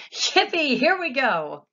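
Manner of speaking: high, loud whoop; cheering as they rise; excited shout. A narrator's reading. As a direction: ride the thrill. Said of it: "shouted Grandpa Joe again".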